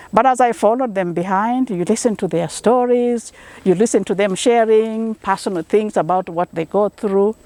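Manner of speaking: ordinary delivery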